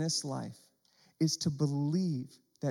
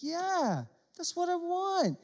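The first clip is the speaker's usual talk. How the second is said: excited; 11 semitones higher, slower than usual. Pretend. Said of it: "I'm like".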